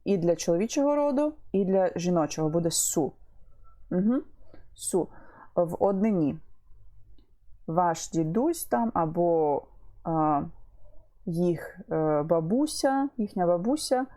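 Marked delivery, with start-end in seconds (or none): none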